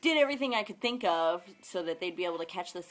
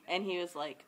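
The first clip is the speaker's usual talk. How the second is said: normal talking voice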